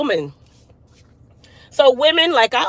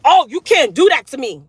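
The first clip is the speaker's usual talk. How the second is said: sharp raised retort, louder; exploding at someone; 3 semitones higher, 6 dB louder than usual. Retold or imitated. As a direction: snap back in open anger. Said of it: "now I had to explode and tell you".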